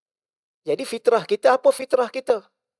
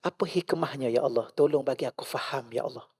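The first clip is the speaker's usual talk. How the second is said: soft, hushed plea to God; a humble, softened supplication; quiet prayer; 7 semitones lower, 12 dB quieter than usual. Retold or imitated. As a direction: pray it gently and much softer. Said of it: "Tanya Allah".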